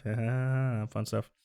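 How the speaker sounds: ordinary talk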